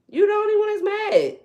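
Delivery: high, loud taunting repeat of the line; mocking shout. Real, not acted.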